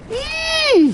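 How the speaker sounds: piercing high-pitched "eee"; sudden drawn-out squeal. Pretend. Sudden squeal mid-chat.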